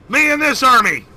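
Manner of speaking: high triumphant shout; leading the charge; loud rallying cry. Acted. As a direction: yell it heroically. Player charges in.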